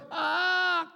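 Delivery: high loud hammed-up cry; mock push scream. Preacher imitating wife's final pushes. Wife pushing in delivery.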